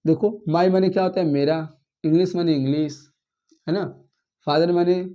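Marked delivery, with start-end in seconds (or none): none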